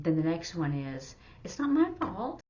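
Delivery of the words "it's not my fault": higher, louder mock protest; mock defensive whine. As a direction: protest your innocence, hammed up. Mocking a blame-dodging excuse.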